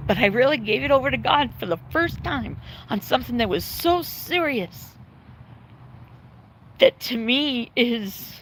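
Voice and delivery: tearful. Real, not acted. Owner crying over her sick dog Duke, voice breaking. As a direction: voice cracking high with tears.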